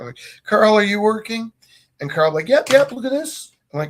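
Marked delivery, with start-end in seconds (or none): 0.4-1.5 higher and louder call. Retold or imitated. Teacher calls Carl. 2.5-3.5 high, loud mock-eager student; a caught kid faking work; fake eager kid. Carl fakes being busy.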